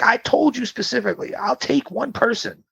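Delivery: high-pitched, insistent reminder; exasperated. Retold or imitated. Insisting on the original agreement.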